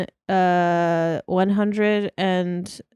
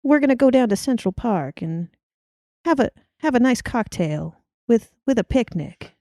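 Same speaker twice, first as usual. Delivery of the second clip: put-on Mid-Atlantic voice, pitched up; Mid-Atlantic accent; 4 semitones higher than usual. Shown as a demonstration. Planning a Central Park picnic, higher Mid-Atlantic accent. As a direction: lay out outing plans in Mid-Atlantic tones. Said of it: "That's the kind of Mid-Atlantic accent".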